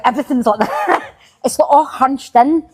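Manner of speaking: normal talking voice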